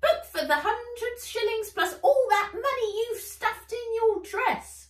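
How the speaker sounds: high, loud squeaky elf voice; greedy elf. Acted. Elf haggling with Nat, high and loud. Elf demands more money.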